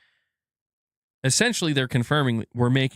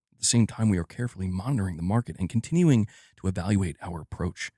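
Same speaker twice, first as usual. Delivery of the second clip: low-key executive impression, pitched down; Herman Hulst; 4 semitones lower than usual. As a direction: speak low as a reserved corporate executive. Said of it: "I'm gonna let Herman take this one".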